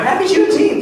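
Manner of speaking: high, upbeat holiday greeting; cheery greeting. Retold or imitated. Comic calling his cousins, cheery greeting.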